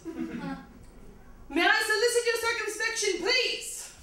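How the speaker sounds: high, slow mock-British recital; slightly bad British accent, drawn out and high; quasi British accent. Comic recites Slim Shady as poetry, slightly bad British accent. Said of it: "slightly bad British accent".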